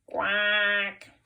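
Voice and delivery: loud duck quack; expressive duck quack. Shown as a demonstration. Teacher leading children in animal sounds, expressive duck quack. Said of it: "say this with loads of expression".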